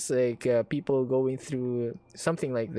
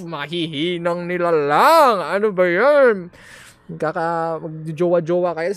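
exasperated rant; 4 semitones higher, 11 dB louder than usual. Real, not acted. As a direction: scold them, fed up. Rants at weak couples.

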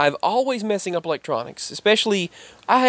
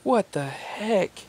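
mock swearing; 5 semitones higher, 6 dB quieter, much slower than usual. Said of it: "She was swearing".

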